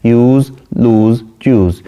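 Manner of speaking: slow, each rhyme word set apart; use, लूज, choose each said slowly with a pause between; rhyme words spelled out. Shown as a demonstration. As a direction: list the rhymes one by one, slowly. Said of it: "last word है use".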